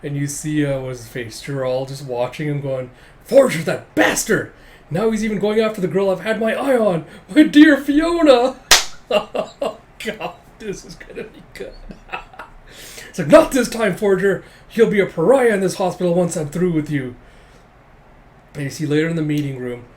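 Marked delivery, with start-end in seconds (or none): 3.2-8.5 high, loud melodramatic fuming; mock-anguished outburst, pitch climbing; hammed-up jealous outrage. Jealous rival acted out in retelling. 8.6-12.1 voiceless, wheezing with laughter; real laughing. Real, not acted. Reader cracks up at the story. 13.3-17.1 loud, raised mock-villain threat; punchy opening burst of mock fury; hammed-up vengeful vow. Rival vows revenge, acted.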